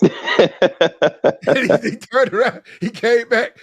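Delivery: real laughter. Real, not acted. Storyteller recalling his friend coming back, laughing through the words. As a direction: crack up while telling it.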